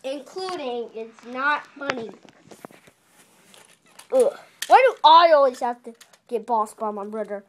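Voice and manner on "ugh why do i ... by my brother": loud grumpy character; grumbling train voice. Pretend. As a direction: complain loudly about the brother. Toy train complaining about brother.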